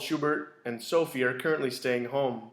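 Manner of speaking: plain reading voice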